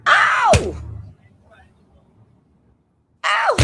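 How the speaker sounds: sudden high pained yelp; sharp cry of pain. Real, not acted. Ammonia burning, cries out.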